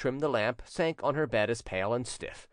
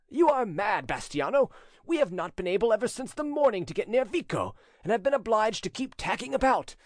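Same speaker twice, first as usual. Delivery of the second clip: high-pitched urgent protest; calling out; 9 semitones higher than usual. A narrator's reading. Boatman arguing with his companion at sea, calling out. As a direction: raise the pitch, arguing over a storm.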